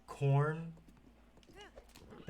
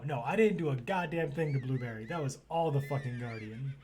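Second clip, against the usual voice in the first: usual speaking voice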